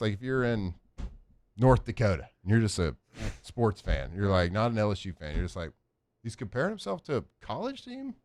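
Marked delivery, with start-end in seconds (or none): 6.2-8.1 high, softer disbelieving question; puzzled outsider questioning it, pitch lifted; quietly baffled, voice going up; imagined incredulous fan. Acted. Imagined neutral fan reacting in disbelief.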